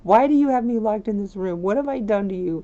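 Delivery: whiny pleading Sim. Acted. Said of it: "She's like".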